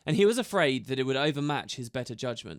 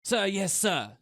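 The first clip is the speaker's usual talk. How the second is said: higher, crisp soldier's reply; to attention; 6 semitones higher, slower than usual. A narrator's reading. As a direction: snap to attention.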